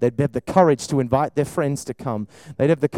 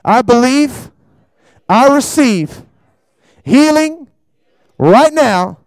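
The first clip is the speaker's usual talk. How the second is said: shouted, high preaching prayer; full-force preacher praying for healing; fervent healing prayer; 5 semitones higher, 8 dB louder, slower than usual. Real, not acted.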